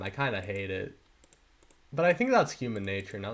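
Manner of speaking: ordinary talk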